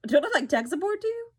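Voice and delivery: high, loud, fast indignant retort; mock indignant. Her retort to the professor who asked her to fix the projector, mock outraged. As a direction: fire it back in mock outrage.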